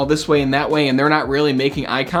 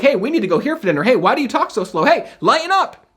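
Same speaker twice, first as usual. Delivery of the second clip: rapid-fire, rising higher at the end; talking too fast; 5 semitones higher, faster than usual. Shown as a demonstration. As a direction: rattle it off at a rush. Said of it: "talking to them like this".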